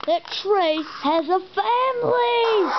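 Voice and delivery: high, slow pretend wail; hammed-up slow high wail; mock wailing cry. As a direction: cry it out in playful alarm. Character cries about tray family.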